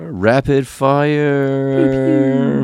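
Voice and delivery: high, slow hype announcer; drawn-out game show announcement. Pretend. Announcing the shout-out segment.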